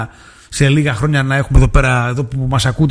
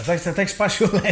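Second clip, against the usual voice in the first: soft voice breaking into a laugh; 3 semitones higher, 7 dB quieter, faster than usual. Real, not acted. Host teasing someone in the studio, laughing. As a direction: crack up while talking. Host cracks up at own remark.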